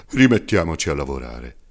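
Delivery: a hint of an old man getting back to work; lightly voiced old carpenter. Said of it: "disse allora ridendo".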